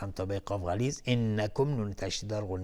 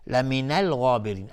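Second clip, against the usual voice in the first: usual speaking voice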